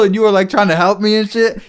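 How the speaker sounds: ordinary talk, nothing marked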